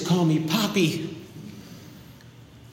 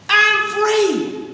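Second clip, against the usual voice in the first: high, elated shout of freedom; joyful cry; 12 semitones higher than usual. Acted. Freed debtor skipping down the street, high elated cry. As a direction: sing out the relief.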